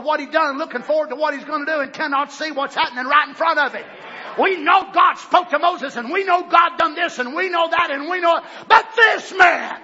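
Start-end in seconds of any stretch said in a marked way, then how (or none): 4.4-9.8 preaching shout. Acted. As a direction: hammer each we know home. Preaching at full force.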